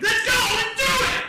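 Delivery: high, very loud hype yell; gung-ho battle cry; pumped-up shouting. Acted. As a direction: holler it, raring to go. Acts out eagerness for more tattoos.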